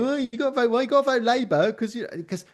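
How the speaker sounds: high, pushy mock quote; mocking nagging voice. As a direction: parody an insistent nagging email. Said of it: "And the email going".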